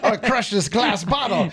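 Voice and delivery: slow dumb macho voice; mock caveman boast; Gaston voice. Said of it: "Gaston from Beauty and the Beast".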